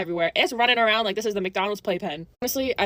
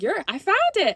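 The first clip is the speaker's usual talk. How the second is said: excited; 8 semitones higher than usual. Retold or imitated. Triumphant find.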